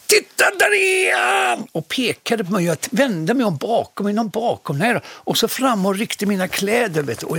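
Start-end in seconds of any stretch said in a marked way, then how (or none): 0.1-1.7 high excited shout of a school class; retold kids' shout. Schoolkids on the subway spotting him, excited shout. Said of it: "en hel skolklass som bara skrek".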